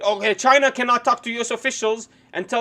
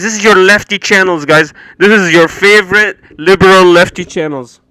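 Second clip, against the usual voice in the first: sarcastic jab; 4 semitones lower, 10 dB louder than usual. Acted. Sarcastically mocks the show's branding.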